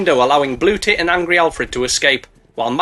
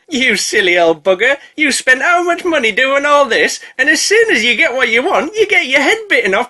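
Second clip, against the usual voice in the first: higher, louder teasing snake voice; mocking snake; 9 semitones higher, 3 dB louder than usual. Narrator voicing the mega snake taunting Gimli, mocking. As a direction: gloat over the foolish old man.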